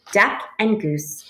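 plain reading voice

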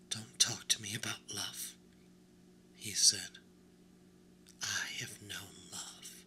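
grief he can barely voice; hushed grief. Acted. Edward silences the doll with a low whispered grief.

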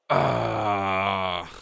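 long, loud drawn-out groan; frustrated groan. Real, not acted. Player who just lost his prison, long frustrated groan. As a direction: let out a sustained groan of frustration. Groans after losing the game.

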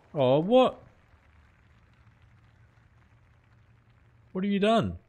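high, loud what have you done; exasperated at the batter's blunder; disbelieving outburst. Real, not acted. Streamer scolds his batter for getting out, in disbelief.

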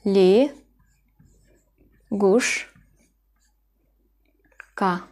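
Li, guş, ka split into slow syllables. Shown as a demonstration. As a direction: break Li guş ka into separate beats. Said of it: "ya yerine i gibi okunur". (heceleyerek Li, guş, ka)

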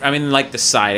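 ordinary delivery

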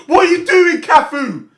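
high, loud yell at the player; exasperated yell. Real, not acted. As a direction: shout in disbelief at the mistake. Scolding player on screen.

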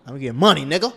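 mock outburst of bragging; loud brag.

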